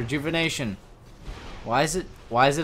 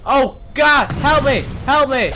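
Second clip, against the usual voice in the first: high, loud, repeated cries; panicked yelling; 11 semitones higher, 8 dB louder, slower than usual. Real, not acted. Player under attack begging for help, yelling in panic. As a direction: cry out for rescue in a game panic.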